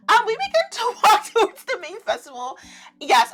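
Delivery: ordinary talk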